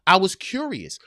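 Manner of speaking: ordinary delivery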